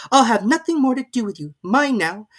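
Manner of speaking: put-on high, loud girlish scolding; a little girl being very stern; serious Alice. A narrator's reading. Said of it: "said Alice seriously".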